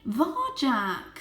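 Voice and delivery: put-on a mother calling a child to order; mother's reproach. A narrator's reading. Mother cat cuts off her son, reproachful. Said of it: "said Mother".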